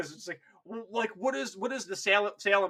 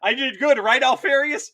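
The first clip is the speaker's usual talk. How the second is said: put-on higher, louder, childish seeking praise; mad radio man; 7 semitones higher, 7 dB louder than usual. Crazed radio operator asking himself for praise. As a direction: beg for approval like a lost child. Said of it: "And he's like".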